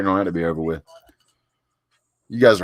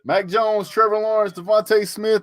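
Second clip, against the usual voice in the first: excited; 13 semitones higher than usual. Real, not acted. Excited names.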